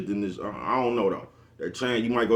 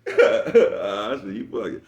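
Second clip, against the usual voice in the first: laughing; 6 dB louder, much slower than usual. Real, not acted. Still laughing after joke.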